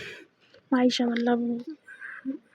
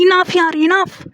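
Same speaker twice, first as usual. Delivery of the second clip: much higher and louder, fast angry yell; loud outburst; 6 semitones higher, 7 dB louder than usual. Retold or imitated. Maysha shouting at her girlfriend to shut up. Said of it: "Maysha बहुत ज़ोर से बोलती है".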